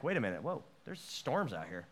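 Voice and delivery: a hint of panic at sea; second-guessing the leap, quietly; mock scared.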